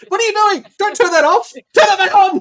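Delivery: high, frantic shouted orders; loud, high-pitched barrage of shouting; acted yelling. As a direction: yell over each other like a panicking crew. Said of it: "are just yelling constantly".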